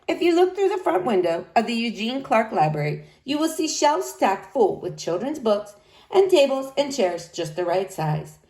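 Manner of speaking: ordinary delivery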